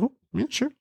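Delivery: put-on small, high, soft child voice; little kid. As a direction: imitate a little daughter agreeing quietly. Acting his little daughter.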